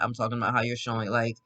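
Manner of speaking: ordinary delivery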